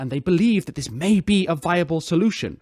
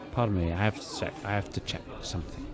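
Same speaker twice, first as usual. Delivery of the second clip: fast, much softer excuse fading out; captain rushing off mid-thought; hurried mumble; 6 semitones lower, 10 dB quieter, faster than usual. Acted. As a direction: slip away muttering.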